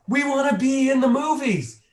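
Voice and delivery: high, loud eager chorus; eager crowd voice. Retold or imitated. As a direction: mimic an excited crowd shouting their wish. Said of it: "And they said".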